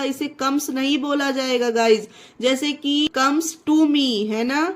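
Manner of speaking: usual speaking voice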